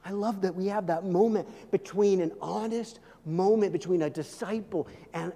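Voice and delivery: normal talking voice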